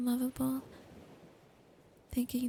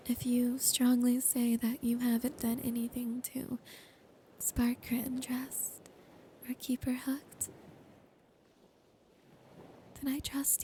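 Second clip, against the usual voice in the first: whisper. Acted. Girlfriend promising her partner trust and marriage, whispered. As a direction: close intimate ASMR whisper throughout.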